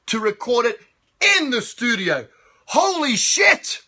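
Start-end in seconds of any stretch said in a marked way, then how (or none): 2.7-3.8 excited shout. Real, not acted. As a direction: blurt it out in disbelief. Shocked shout at news.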